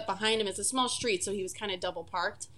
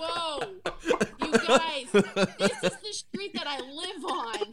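laughing protest; 5 semitones higher, slower than usual. Retold or imitated. Retold embarrassed plea to friend.

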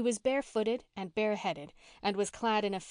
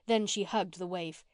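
plain reading voice

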